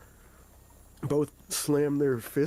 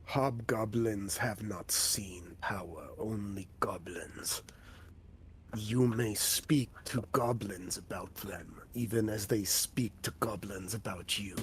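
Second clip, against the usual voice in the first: whispery hobgoblin; 4 semitones lower, 6 dB quieter than usual. Acted. Hobgoblin warning the party about goblins, low whisper. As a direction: hush it like a sly creature.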